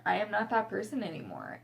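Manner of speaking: ordinary delivery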